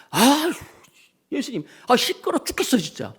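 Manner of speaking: 예수님께 짜증내며 하소연하는 제자들, 높고 칭얼대는 목소리; annoyed disciples. Retold or imitated. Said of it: "제자들이 막 이렇게 예수님께 말씀을". (fed up, pitched way up)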